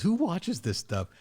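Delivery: lightly hammed-up scoffing buddy, voice pushed up high; mocking friend. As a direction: mimic the friend sneering at criers. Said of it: "he said".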